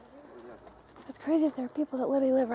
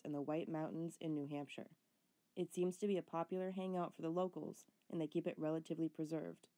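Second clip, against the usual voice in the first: documentary voiceover; 6 semitones lower, 15 dB quieter than usual. A narrator's reading. Voiceover narration of location.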